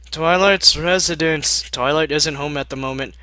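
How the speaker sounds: loud stiff butler voice; formal greeting. Acted. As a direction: announce it like a butler. Answering door formally.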